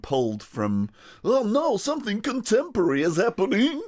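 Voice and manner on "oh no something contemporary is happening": higher, hammed-up prim shock; a hint of a scandalised posh audience; mock horrified audience. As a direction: gasp like a scandalised concertgoer. Mocking shocked classical audience.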